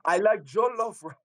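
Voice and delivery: playful put-on West African voice; West African accent. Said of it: "in a West African dialect and be like".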